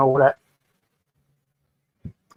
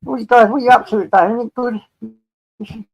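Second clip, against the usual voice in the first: chirpy question; 8 semitones higher, 5 dB louder than usual. Real, not acted.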